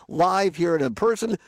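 ordinary talk

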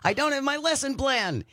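high-pitched mock-panicked dream cry. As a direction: blurt it out like an anxious dreamer. Voicing the recurring anxiety dream.

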